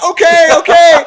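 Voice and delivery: very high, loud, stretched-out okays; thrilled agreement. Retold or imitated. Teen accepting his idol's invitation to come over, thrilled. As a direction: squeal it slowly, replaying giddy excitement.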